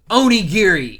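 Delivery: loud, high battle cry; attack call. Retold or imitated. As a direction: shout the move name mid-fight. Calling out Zoro's attack name.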